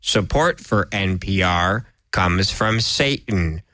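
NPR sponsor read. Pretend. Mock NPR sponsor announcement.

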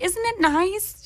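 drawn-out British purr, high up; British accent. Retold or imitated. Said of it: "that was all in a British accent".

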